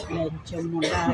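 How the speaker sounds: low, slow, spat-out curse; low contemptuous curse. Real, not acted. Vlogger curses her online critics, low and slow. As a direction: drag the insult out low and slow. Cursing commenters in rant.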